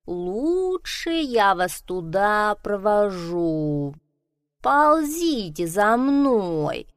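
very slow, unhurried snail. Acted. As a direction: stretch every word like a snail. Snail speaks slowly. (очень медленно, как улитка)

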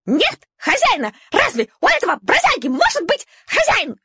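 very high, fast, shrill outcry; piercing squeal. Acted. Little dog shrieking to the others about the ownerless puppy. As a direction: shriek it as a scandal. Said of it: "пронзительно взвизгнула Шайба".

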